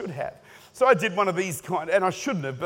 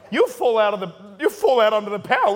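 laughing; 5 dB louder than usual. Acted. Joking about anointed hanky.

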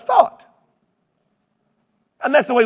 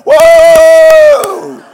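loud, high joyful whoop; church whoop; 7 semitones higher, 14 dB louder than usual. Retold or imitated. Whole church cheering the heaven promise with a loud whoop. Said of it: "the whole church would go".

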